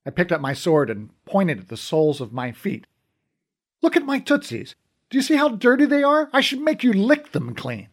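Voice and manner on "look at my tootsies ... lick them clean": much higher theatrical taunting voice; mock menace. A narrator's reading. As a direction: ham up the threat to scare him. Mock threatening the frightened thief.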